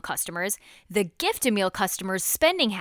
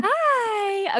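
puppy talk voice; 12 semitones higher than usual. Real, not acted. Greeting the puppy.

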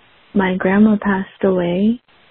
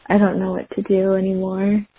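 ordinary delivery

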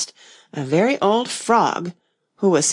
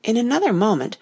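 usual speaking voice

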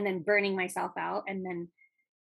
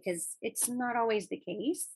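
normal talking voice

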